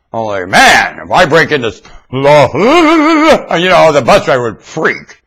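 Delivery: normal talking voice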